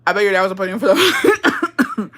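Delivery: cracking up mid-sentence; laughing. Real, not acted. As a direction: joke dissolving into giggles. Breaks up at own dirty joke.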